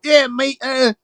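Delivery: high-pitched Cockney chav impression; chav accent. Mimicking the South London chavs for his friends, chav accent. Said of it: "that's the chavs".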